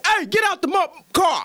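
loud, high Cockney carjacker; high-pitched Cockney yell; Cockney accent. Shown as a demonstration. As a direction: shout it like a Cockney robber. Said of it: "Little Cockney".

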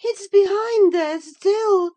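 high, loud anxious cry; fearful invalid, voice pitched right up. A narrator's reading. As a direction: insist in high-pitched alarm. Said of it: "she pursued anxiously".

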